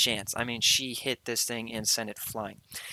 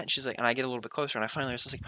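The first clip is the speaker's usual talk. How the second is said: ordinary talk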